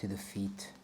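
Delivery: calm, soft meditation guide voice; guided meditation. Acted. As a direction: ease them into a body scan. Leads a grounding meditation.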